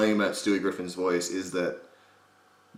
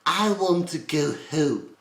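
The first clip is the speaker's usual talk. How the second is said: high, slow, less British cartoon child; higher, softened-accent character whine; Stewie Griffin; 8 semitones higher, much slower than usual. Shown as a demonstration. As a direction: draw it out high, lightly British. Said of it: "cheerful, I guess, a little less British and a little high-pitched".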